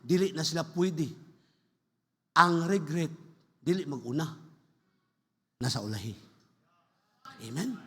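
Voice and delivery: normal talking voice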